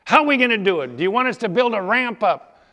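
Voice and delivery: high, loud demand for a plan; baffled loud questioning. Acted. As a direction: press the boss loudly for answers. Warriors question Joshua about the plan.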